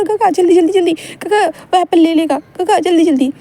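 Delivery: put-on high, eager child calling out to hurry; excited urging. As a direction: shout him on like a thrilled kid on the back of a bike. Child urging on bicycle chase.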